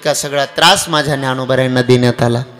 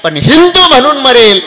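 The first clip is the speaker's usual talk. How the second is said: high, loud defiant cry; defiant refusal; 11 semitones higher, 8 dB louder than usual. Acted. Sambhaji refusing Aurangzeb, defiant loud cry. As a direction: refuse with pride. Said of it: "Sambhaji महाराजांनी नकार दिला".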